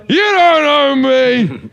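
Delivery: high, loud, slow indignant yell; mock indignant shout. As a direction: bellow it back, pitched high and drawn out. Retold outburst at bar table.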